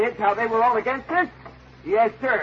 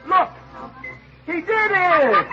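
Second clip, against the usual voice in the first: delighted; 9 semitones higher, slower than usual. Real, not acted. Trick succeeds, host delighted.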